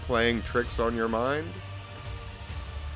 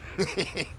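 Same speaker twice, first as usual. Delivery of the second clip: sinister chuckle; 8 semitones higher, 6 dB quieter than usual. Acted. Vizier laughs after proclaiming.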